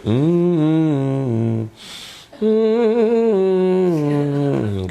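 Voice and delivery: long drawn-out hum, sliding down; pretend browsing hum. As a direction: feign interest with a stretched, high hum. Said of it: "pura-pura, hmm, sambil". (gumaman panjang pura-pura melihat)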